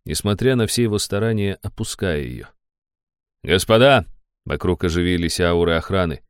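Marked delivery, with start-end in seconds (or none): 3.5-4.1 sharp shouted call; loud call. A narrator's reading. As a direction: call out to them. Guards call out.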